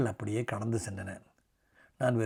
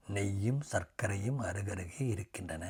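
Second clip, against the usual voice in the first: ordinary delivery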